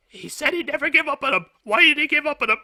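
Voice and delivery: high, loud mock whine; salty fanboy. Podcaster mocking a salty fanboy's trivia entry, loud high wail. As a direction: wail it like an outraged fan. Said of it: "that is a salty fanboy".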